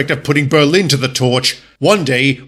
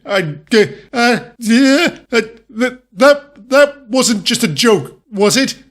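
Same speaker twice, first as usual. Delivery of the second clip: nervous stammer; 7 semitones higher, slower than usual. Acted. Nervous after the half-German reveal.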